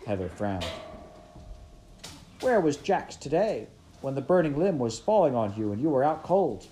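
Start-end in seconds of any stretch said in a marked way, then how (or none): 2.4-6.7 higher, louder playful jab; teasing. A narrator's reading. Heather teasing Pickett about fainting, playful. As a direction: needle him, half joking. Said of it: "she teased".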